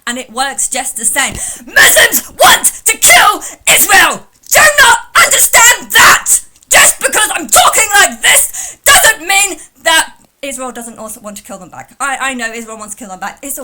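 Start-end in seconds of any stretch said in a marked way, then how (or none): 1.6-10.7 high, loud screaming parody with laughter breaking through; parody rant. Retold or imitated. Mimicking the caller's style, laughing shout. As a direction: scream it back at him, half laughing. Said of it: "I can talk like this too".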